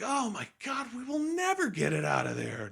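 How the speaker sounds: high, whiny despair; despairing Luke. Retold or imitated. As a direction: wail it hopelessly. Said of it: "Luke's like".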